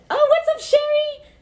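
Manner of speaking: high, loud squeal of hello; excited greeting. Real, not acted. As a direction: greet her like a long-lost friend. Greeting friend joining the live.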